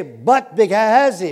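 slow, loud call of "But the Gehazi"; "Gehazi" drawn out and pressed for the crowd to repeat; called out for the congregation. Shown as a demonstration. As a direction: lead the room to echo "Gehazi" slowly. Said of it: "everybody say, say".

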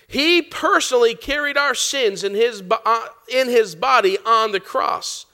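slow, deliberate scripture reading. Acted. Preacher reading scripture slowly to the church. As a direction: lean on each word of the verse.

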